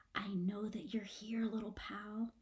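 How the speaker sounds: soft unvoiced whisper to a hidden pet. Izzy coaxing her hidden possum, whispering. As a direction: whisper so only the possum hears. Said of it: "She whispers to the room".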